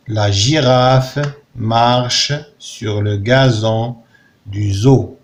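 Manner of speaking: teacher reading the sentence slowly for pupils; slow model reading. Shown as a demonstration. Teacher models the sentence for the class, slow and careful. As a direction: read it slowly, word by word. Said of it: "Lis les phrases".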